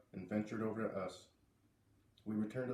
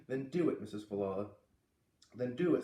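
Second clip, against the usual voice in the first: put-on Missus Falala accent. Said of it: "Your accents are great".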